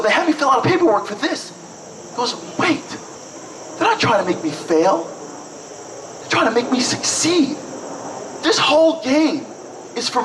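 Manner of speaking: high-pitched, excited piecing-together; dawning realization. Retold or imitated. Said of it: "finally something dawns on him".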